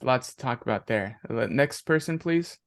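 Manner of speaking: usual speaking voice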